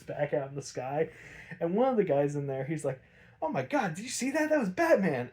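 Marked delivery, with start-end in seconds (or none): 3.4-5.3 higher, awed gasp of disbelief; amazed. Retold or imitated. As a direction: retell a stunned guy, pitch up. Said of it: "one guy in utter amazement".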